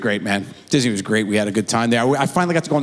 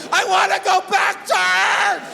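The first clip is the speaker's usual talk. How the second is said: put-on terrified kid. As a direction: cry out like a scared little boy. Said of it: "hugging the guardrail going".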